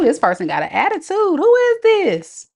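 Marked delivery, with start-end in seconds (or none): 1.4-2.5 high stretched "Who is this?"; hammed-up outrage; mock indignant squeal. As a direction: play shocked and offended, high and slow.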